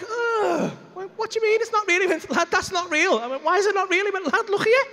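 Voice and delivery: high-pitched acted back-and-forth; exasperated, high squabble between mates; indignant bickering. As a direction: argue it out, voice pitched right up. Acted argument with sceptical mate.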